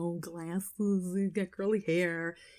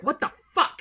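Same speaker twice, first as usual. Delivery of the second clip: shocked outburst; 7 semitones higher, 4 dB louder than usual. Retold or imitated. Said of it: "Garrett's like".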